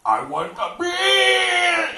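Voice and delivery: high, very slow drawn-out yell; the gringo's stretched-out shouted demand. Retold or imitated. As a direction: bellow it and stretch every word. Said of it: "y lo grita y lo alarga".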